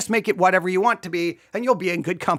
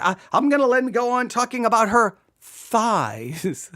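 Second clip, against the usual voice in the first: ordinary talk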